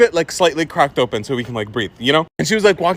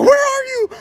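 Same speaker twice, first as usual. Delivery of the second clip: much higher, shouted question; mock yell. Panicked mom shouting for her hidden son. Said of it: "she's like".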